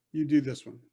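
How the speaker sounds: ordinary talk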